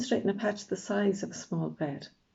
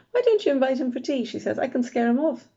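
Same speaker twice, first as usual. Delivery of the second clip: lightly voiced mum; 6 semitones higher, 5 dB louder than usual. A narrator's reading. Mum teasing about the boy.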